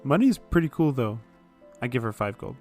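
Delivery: ordinary talk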